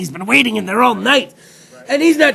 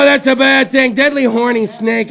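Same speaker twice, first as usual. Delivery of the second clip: normal talking voice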